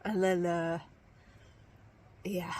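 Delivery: ordinary talk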